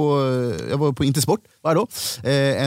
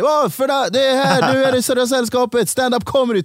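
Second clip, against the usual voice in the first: much higher, louder hawker pitch; hammed-up barker; 11 semitones higher, 5 dB louder than usual. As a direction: lure passers-by like a street barker. (överspelad inkastare, mycket högre och starkare)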